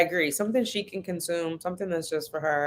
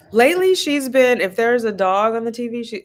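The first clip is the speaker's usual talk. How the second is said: normal talking voice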